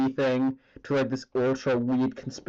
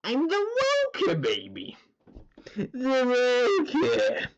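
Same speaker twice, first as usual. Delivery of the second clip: Mark Hamill; 12 semitones higher, slower than usual. Acted. Streamer replaying the funny word to chat, theatrical high villain voice. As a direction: announce it like a cartoon villain, last word high and drawn out. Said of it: "tried to do the Mark Hamill voice".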